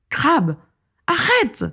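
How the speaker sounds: calling out, climbing to a high cry; acted, desperate yell; urgent shout. A narrator's reading. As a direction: order him to stop at the top of the voice. Vera shouts to stop the villain.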